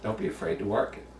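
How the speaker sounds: ordinary talk, nothing marked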